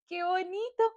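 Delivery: usual speaking voice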